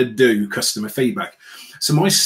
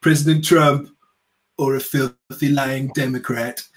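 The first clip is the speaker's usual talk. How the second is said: slow, drawn-out mock Trump accent; hammed-up Trump accent read at half speed; Trump impression. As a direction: play Trump selling a rigged question, slowly. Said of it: "this is my Trump accent".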